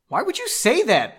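high, loud incredulous question; disbelief. A narrator's reading. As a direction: ask it as if you can't believe it. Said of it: "Pauline asked in disbelief".

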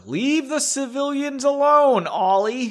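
loud, high, slow call across the room. Acted. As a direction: shout it over to a comrade. Soldier calling over.

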